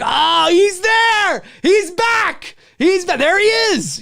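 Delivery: high-pitched audience outcry; drawn-out, excited high exclamations; thrilled alarm. Acted. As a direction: react like viewers spotting the killer. Acted audience spotting the killer.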